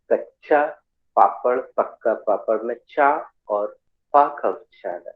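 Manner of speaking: ordinary delivery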